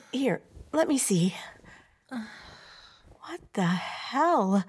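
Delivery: very slow stunned whisper. Acted. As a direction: hush it, staring in disbelief. Inspecting up close, whispered.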